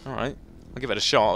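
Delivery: ordinary delivery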